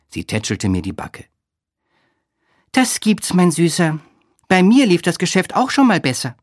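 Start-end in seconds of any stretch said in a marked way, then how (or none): 2.7-6.4 high-pitched character voice, consoling; Olga, consoling friend. A narrator's reading. Narrator voices Olga comforting him.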